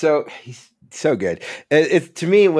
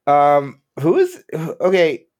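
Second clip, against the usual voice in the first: normal talking voice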